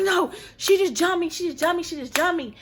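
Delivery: high-pitched mock yelling without much volume; retold frantic screaming. Telling Mike on FaceTime she just got jumped, mock screaming. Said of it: "I'm screaming at him".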